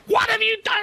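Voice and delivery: very high-pitched screech, imitating her; mock banshee scream. She rang about the column, imitated screaming. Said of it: "come screaming, ... screaming banshee".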